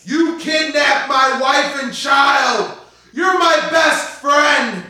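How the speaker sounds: high, slow anguished shouting; betrayed shout. Acted. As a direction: roar out the betrayal.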